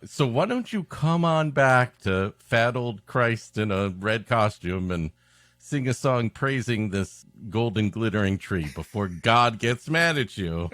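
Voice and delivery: usual speaking voice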